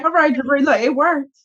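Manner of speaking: high-pitched, smug told-you-so; smug retort. Retold or imitated. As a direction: rub it in with a lofty sing-song. S0 retells her own smug reply.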